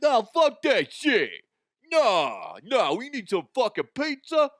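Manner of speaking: loud, high-pitched caricature of angry New Yorkers; exaggerated New York accent in mock outrage. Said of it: "perfect New York accent".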